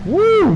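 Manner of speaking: short, loud high "woo"; excited whoop. Real, not acted. Commentator winding down after the finish, high whoop. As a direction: whoop it out in relief and thrill. Still cheering the race finish.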